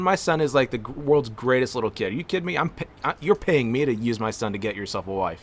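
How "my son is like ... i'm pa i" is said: ordinary delivery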